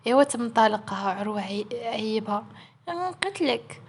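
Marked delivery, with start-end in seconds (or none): 2.9-3.8 high, soft little threat; mock threat. Ayat to Aroua after his teasing, mock threat. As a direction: lightly threaten in a high, small voice.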